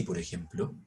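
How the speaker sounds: very low, put-on deep voice; artificially deep voice. Shown as a demonstration. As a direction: press the voice down low. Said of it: "con una voz así".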